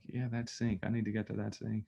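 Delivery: soft, half-under-the-breath self talk; muttering to self. Retold or imitated. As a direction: mumble it to yourself, avoiding the chore. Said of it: "you're like".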